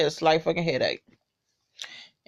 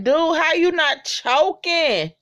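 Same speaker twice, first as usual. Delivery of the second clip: disbelief; 8 semitones higher, slower than usual. Retold or imitated. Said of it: "I'm like".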